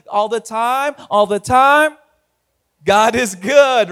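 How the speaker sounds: loud call-and-response, building; rousing call. Real, not acted. Pastor leading the church's familiar response, rousing and loud. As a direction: belt it out for the congregation, stretching the end.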